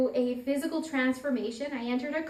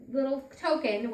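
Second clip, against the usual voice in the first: ordinary delivery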